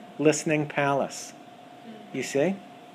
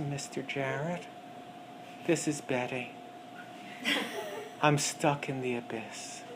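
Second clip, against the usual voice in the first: despairing voice; 6 dB quieter than usual. Acted. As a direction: sound lost and hopeless in the abyss. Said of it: "then you can hear weeping".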